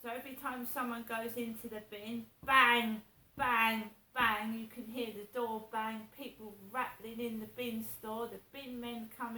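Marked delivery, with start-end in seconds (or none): 2.4-4.5 sharp loud bangs fading off. Retold or imitated. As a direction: slam out each bang like the door. Imitating bin door slams.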